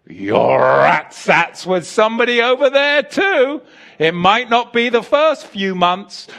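loud, high fiery preaching, almost shouting; full-force preaching. Real, not acted. Preacher rousing the congregation with a loud, high call. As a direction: preach it hard to the back row.

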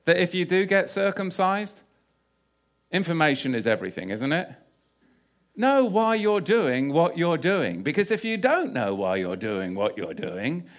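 ordinary talk

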